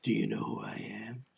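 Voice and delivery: low, hushed whisper; whispered Batman. Acted. Batman confronting club goons, low menacing whisper. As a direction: whisper it like a masked vigilante.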